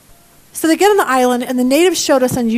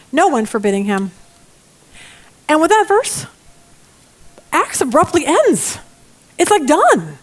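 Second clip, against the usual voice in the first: ordinary talk, nothing marked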